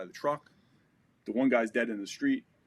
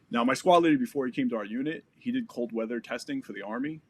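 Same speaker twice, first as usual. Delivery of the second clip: usual speaking voice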